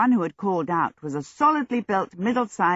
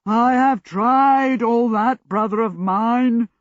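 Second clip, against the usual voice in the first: slow, loud boom of a giant; big exasperated booming voice; booming djinn; 4 semitones higher, 6 dB louder, slower than usual. A narrator's reading. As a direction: boom it out, vowels stretched. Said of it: "Hasruel boomed".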